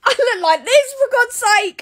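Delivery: exasperated squeal. Real, not acted. Creator showing her bad hair, high exasperation. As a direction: throw it up high in disbelief.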